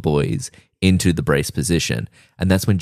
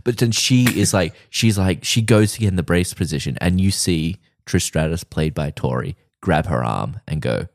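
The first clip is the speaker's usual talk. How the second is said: ordinary talk, nothing marked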